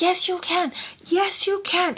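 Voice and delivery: high-pitched mock insistence; insistent retort. Girl contradicting him in a quarrel, high insistent imitation.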